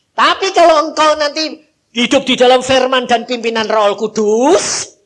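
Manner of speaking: preaching at full force. Acted. Preacher urging the church, loud. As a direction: proclaim it to the congregation.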